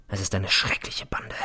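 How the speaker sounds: hushed, dread-filled whisper; a low whisper of dread. Acted. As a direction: breathe it out as a private fear. Dark private thought.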